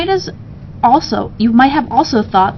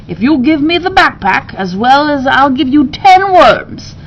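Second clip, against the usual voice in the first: bird keeper voice; 5 semitones higher, 4 dB louder than usual. Acted. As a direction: drag out the deal like a sly trader.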